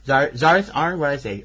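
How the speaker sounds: ordinary talk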